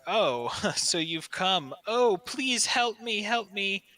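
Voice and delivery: high, slow desperate pleading; pleading dragon. Acted. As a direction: beg for help, drawn out. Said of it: "he's like".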